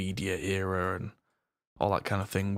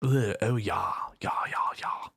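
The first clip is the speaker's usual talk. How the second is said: mock posh disdain. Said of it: "I really kind of despise that".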